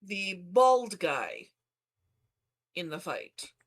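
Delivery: usual speaking voice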